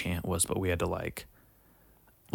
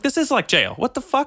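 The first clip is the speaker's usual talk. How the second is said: much higher, incredulous exclaim; incredulous outburst; 5 dB louder than usual. Retold or imitated. New recruit realizing camp feels like jail, incredulous. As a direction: blurt it out in disbelief.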